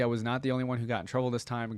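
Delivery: normal talking voice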